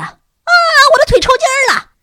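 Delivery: very high, loud wail of pain; crying out loudly. A narrator's reading. Pupil crying out to roommate over leg cramp, wailing. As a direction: yelp as cramp grips you. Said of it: "布茨大声地叫唤了起来".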